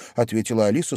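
plain reading voice

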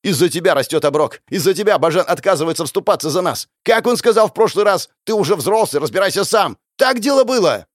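high shouted accusations, building to a peak; unrelenting fury. A narrator's reading. Hero blames the elder for everything, shouting. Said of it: "и не думая успокаиваться".

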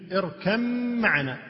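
"اركب معنا" as irkamm-ma'ana, long hummed m; assimilated mim. Shown as a demonstration. Teacher explaining the merged letters, assimilated mim. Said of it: "تدغم الباء بالميم".

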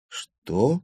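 ordinary delivery, nothing marked

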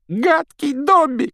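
high, loud self-scolding cry; shouting. A narrator's reading. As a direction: shriek it at yourself. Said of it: "кричал он при этом".

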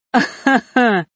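loud, high acted guffaw. A narrator's reading. Харон enjoys the spectacle, guffawing loudly. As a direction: burst out in hearty laughter. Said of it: "снова загоготал Харон".

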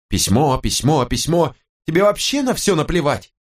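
high, indignant repetition building to reproach; fed-up wife, voice climbing; exasperated wife. Acted. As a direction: repeat it mockingly, then pitch up in accusation. Wife explodes in quarrel.

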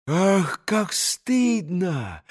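slow, hushed shame; ashamed exclamation. A narrator's reading. Grushenka learns of the cheating, slow hushed shame. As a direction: draw it out softly, mortified. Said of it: "воскликнула Грушенька".

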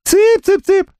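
very high thin squeaky voice; тонким голосом. A narrator's reading. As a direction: pipe it up like calling chickens. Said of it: "Солёный тонким голосом". (писклявым тонким голосом)

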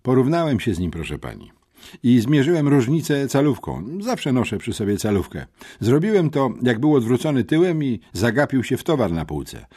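lekko podniesiony głos rzeczowego chłopca; przejęty uczeń. A narrator's reading. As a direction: report it like an earnest schoolboy witness. (przejęty, rzeczowy uczeń)

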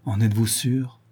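ordinary delivery, nothing marked

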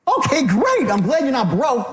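way too chirpy a comeback; over-upbeat wrong reply. Acted. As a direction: gush it like it's wonderful news. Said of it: "No, you're not gonna say that either".

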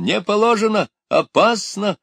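high-pitched loud alarm; shouting. A narrator's reading. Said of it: "закричал он". (тревожный высокий крик)

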